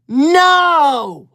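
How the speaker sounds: a high, loud, drawn-out No!; loud mock-offended no; indignant shout. Denying she will cry.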